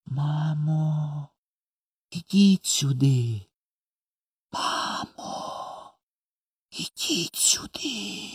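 soft, slow call fading to a whisper; hushed, dreamy calling. A narrator's reading. Boy calls mother in the sky, hushed and dreamy. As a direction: call with lips alone, as through sleep. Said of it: "одними губами, мов крізь сон, почав кликати".

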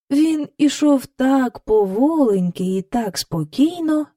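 normal talking voice

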